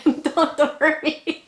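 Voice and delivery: high mock-scared plea. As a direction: play scared for a laugh. Fake plea to viewers.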